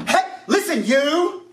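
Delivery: high-pitched, hammed-up accusing rant; comically overdone angry accusations; mock confrontation. Acts out angry confrontation, misapplying advice.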